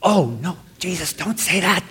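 hushed, worried plea to stop; mock alarmed disciple. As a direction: plead softly like a nervous follower. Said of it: "I'm like".

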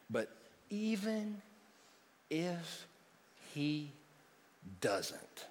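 But even if he doesn't, each word alone, soft with long pauses. Shown as a demonstration. Preacher lingering on the key phrase, spaced. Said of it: "words spaced with long pauses".

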